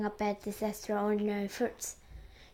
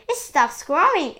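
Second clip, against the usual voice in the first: high, loud exclamation; cried out; 6 semitones higher, 10 dB louder than usual. A narrator's reading. An aunt sees the peach stop, cried out. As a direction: cry it out excitedly. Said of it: "and Spiker cried".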